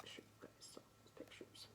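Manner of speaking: fast, soft whispered aside. Real, not acted. Aside to the children.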